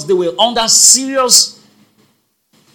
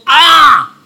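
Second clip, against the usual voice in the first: loud, high exclamation of dismay; Yoruba shout; 7 semitones higher, 8 dB louder than usual. Acted. Jeremiah protesting to God, Yoruba shout. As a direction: cry out in protest, Yoruba style. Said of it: "the Yoruba will shout".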